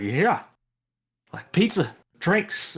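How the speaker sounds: mock crowd cheer. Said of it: "hear a couple of people cheer".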